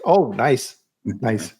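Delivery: usual speaking voice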